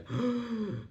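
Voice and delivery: sharp high gasp of shock; imitating her shocked gasp. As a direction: gasp as if hit by the news. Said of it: "And she was like".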